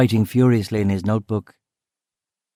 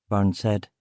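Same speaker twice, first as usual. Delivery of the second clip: ordinary delivery, nothing marked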